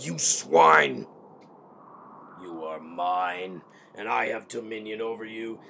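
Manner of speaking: rasping villain whisper swelling into a roar; a tyrant gloating over a crushed subject, slow and menacing; cold rasping evil emperor. Acted.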